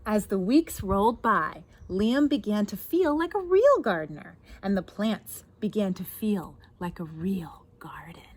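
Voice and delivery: put-on lively read-aloud to children; story narration. A narrator's reading. Reads picture book aloud.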